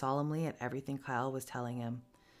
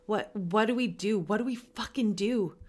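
higher, pleading, near tears; tearful desperation; 6 semitones higher, 5 dB louder than usual. Acted. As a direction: beg through tears. Sam crying, desperate.

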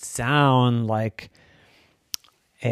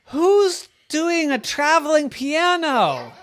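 high, drawn-out calling question; baffled mother; 14 semitones higher, slower than usual. Acted. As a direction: call it out slowly in puzzlement.

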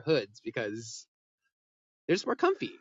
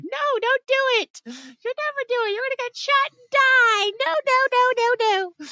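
much higher, louder mock mom pleading; mom's protest; 11 dB louder than usual. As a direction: squeal out a worried mom. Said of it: "My mom was like".

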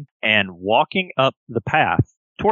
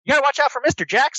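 high, sweet toy-animal voice; childlike plush friend; 12 semitones higher than usual. Acted. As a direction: speak like an eager stuffed elephant bear. GM voices Billy Bucks.

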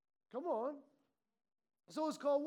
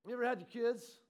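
ordinary talk

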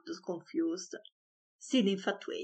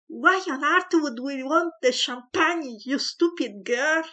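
loud, much higher furious yell; screamed; 7 semitones higher, 7 dB louder than usual. A narrator's reading. Father screaming at the maid over the champagne. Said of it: "Mister Riddle screamed".

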